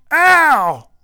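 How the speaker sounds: loud high ow, then a grumbled darn; yelp of pain. Acted. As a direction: cry out as you hit the ground.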